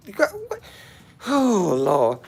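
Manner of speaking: surprised. Real, not acted. Sudden reaction to chat.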